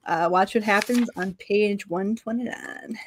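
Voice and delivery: ordinary talk, nothing marked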